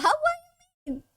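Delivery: high, fast, squeaky little voice. Acted. Sudden squeaky reply.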